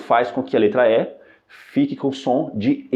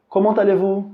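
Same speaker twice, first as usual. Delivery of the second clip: high questioning "Comment allez-vous?"; asking voice; 7 semitones higher than usual. Shown as a demonstration. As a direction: play the asker. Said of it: "se alguém pergunta pra você".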